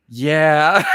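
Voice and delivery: drawn-out "yeah" filling a pause; mimicked tour guide's long filler; imitating tour guide. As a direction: stretch it out as an awkward space filler. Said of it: "She'd go".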